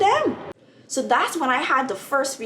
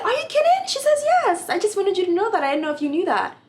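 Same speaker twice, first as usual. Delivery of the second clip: much higher and louder, thrilled; excited disbelief; 11 semitones higher, 4 dB louder than usual. Retold or imitated. Student learning she has the top grade, excited and high. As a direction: squeal it in shocked delight. Shocked exchange with professor.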